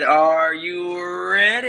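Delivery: loud, high, drawn-out hype call; wrestling announcer. Pretend. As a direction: boom it out like a ring announcer. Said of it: "That's weak as hell".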